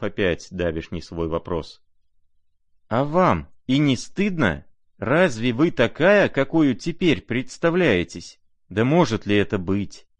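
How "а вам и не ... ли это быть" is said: heartfelt reproach. A narrator's reading. Prince to Nastasya, crying out in heartfelt reproach. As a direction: cry out, pleading with her conscience. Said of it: "вскрикнул вдруг князь с глубоким сердечным укором".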